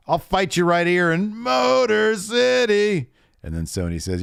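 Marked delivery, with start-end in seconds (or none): 0.1-3.1 much higher, slow challenge, hammed up; mock challenge. Host imitating Charlotte challenging Sonya in the ring, hammed-up slow challenge. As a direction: draw out the challenge like a heel.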